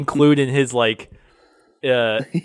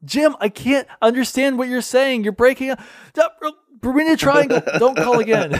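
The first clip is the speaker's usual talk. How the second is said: bad connection; 10 semitones higher than usual. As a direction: fake the line cutting out, high. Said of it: "pretend like phone's breaking up".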